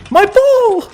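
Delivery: put-on very high, loud child's yelp; child crying out. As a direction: yelp like a little kid losing his ball. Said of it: "you hear another voice say".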